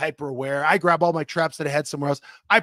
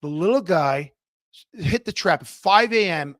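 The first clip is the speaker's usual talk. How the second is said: ordinary talk, nothing marked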